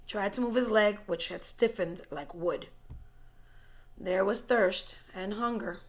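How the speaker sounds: plain reading voice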